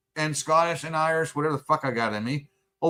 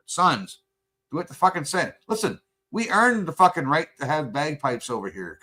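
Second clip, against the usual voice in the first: normal talking voice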